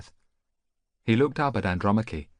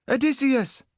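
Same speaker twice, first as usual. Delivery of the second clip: put-on much higher, louder young voice; boy shouting; 6 dB louder than usual. Boy answers Odysseus, high loud shout. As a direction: cry out as a frightened boy. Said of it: "he called out".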